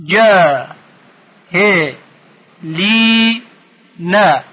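"جا، ه، لی، نه" as four isolated beats; syllable by syllable. Shown as a demonstration. Teacher splitting the last word, syllable by syllable. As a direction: hold "جا" and "لی" long, pausing between parts. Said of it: "بخش کنید".

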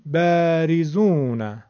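بریزون with first vowel stretched, 'baa-rizoon'; slow, holding the front 'a' of بریزون; doubled fatha. Shown as a demonstration. Teacher showing fatha doubled, long first vowel in بریزون. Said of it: "دو برابر کردیم شد بریزون".

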